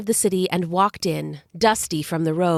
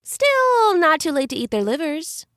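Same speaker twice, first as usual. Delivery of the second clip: higher, teasing jest; joking; 7 semitones higher than usual. Acted. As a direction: tease Zeus with a dark joke. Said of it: "Hermes joked".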